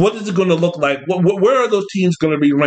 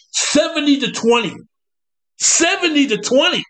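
disbelief; 9 semitones higher than usual. Real, not acted. Repeating score in disbelief.

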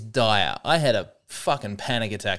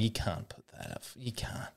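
hushed, much softer replay of fretting to oneself; muttered self-reproach; 2 semitones lower, 14 dB quieter than usual. Retold or imitated. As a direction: mutter it under the breath, retold. Replaying self-reproach on dog walk.

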